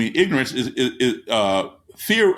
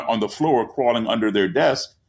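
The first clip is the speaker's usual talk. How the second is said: usual speaking voice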